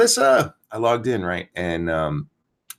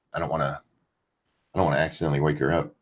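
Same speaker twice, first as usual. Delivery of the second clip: hushed, fast, softer talk; keeping it down; 7 semitones lower, 6 dB quieter, much faster than usual. Real, not acted. Streamer keeping quiet while his wife sleeps, hushed. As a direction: drop the voice so the wife can sleep. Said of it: "I'm trying not to yell too loud".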